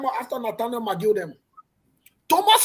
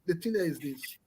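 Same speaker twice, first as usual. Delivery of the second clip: ordinary delivery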